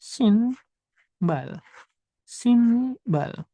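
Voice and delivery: "Sinbal" in two drawn-out syllables, N kept; unassimilated N sound. Shown as a demonstration. Teacher repeating for beginners, "Sinbal" with a held N. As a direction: keep a clear N in "Sinbal", syllable by syllable. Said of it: "aku tanpa bacaan dulu".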